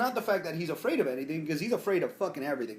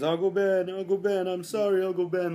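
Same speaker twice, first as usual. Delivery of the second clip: mock crying; 5 semitones higher, 4 dB louder than usual. Retelling Spider-Man crying scene.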